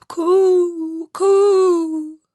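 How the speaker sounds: high cooing bird calls; cooing pigeons. Acted. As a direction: coo like a wood pigeon, high and loud. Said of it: "said the wood pigeons".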